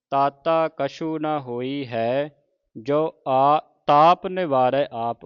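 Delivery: slow, drawn-out Gurbani recitation; scripture recitation. Shown as a demonstration. Teacher models scripture for the congregation, slow measured recitation. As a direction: recite each word slowly with long vowels.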